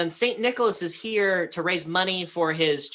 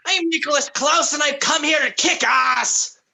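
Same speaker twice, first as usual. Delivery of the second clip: loud, high in-character shout; booming, hammed-up challenge; bellowed battle boast; 9 semitones higher, 5 dB louder than usual. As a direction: announce yourself like a brawling hero. Player announces his character to guard.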